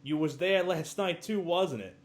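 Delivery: hammed-up accusing question; mock accusing. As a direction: play the suspicious bellhop grilling him. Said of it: "Tim Curry goes".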